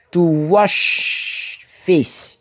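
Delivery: "To wash face" spelled out very slowly, word by word; slow teaching demo. Shown as a demonstration. Teacher slowly models a phrase for learners. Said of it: "si tu comprends un peu le mécanisme".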